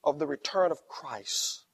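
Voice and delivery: usual speaking voice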